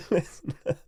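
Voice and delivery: laughing through the words. Real, not acted. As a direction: giggle it out. Laughing through the repeated moral.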